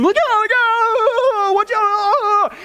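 high, slow, frantic warning cry; hammed-up shrieking wife in the car; wife's panicked scream. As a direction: shriek it like a startled passenger wife. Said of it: "his wife starts to scream".

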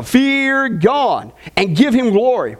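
slow, weighty scripture line; emphatic reading. Acted. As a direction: lean on each word slowly. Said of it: "slow emphatic reading".

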